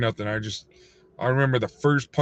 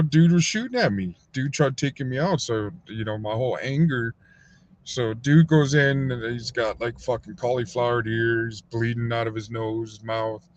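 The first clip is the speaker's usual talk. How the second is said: ordinary talk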